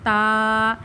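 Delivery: تا held one alif length; Arabic ta. Shown as a demonstration. Teacher models the letter for the students. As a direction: draw تا out for one count. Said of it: "ایک الف کی مقدار".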